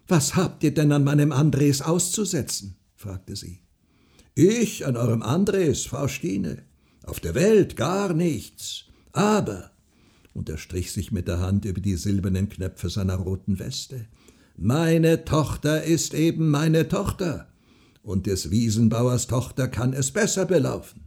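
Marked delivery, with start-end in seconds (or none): 0.1-2.8 mildly indignant, raised pitch; almost angry. A narrator's reading. Mother defends her Andres, almost angry. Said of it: "mit fast zürnenden Augen an". 4.3-9.7 slow, high, emphatic farmer; self-important farmer. A narrator's reading. Farmer answers Frau Stine, slow and self-important. 14.6-21.0 proud farmer. A narrator's reading. Farmer boasts of his daughter, mildly pompous.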